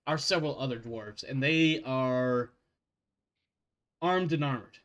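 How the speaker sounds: usual speaking voice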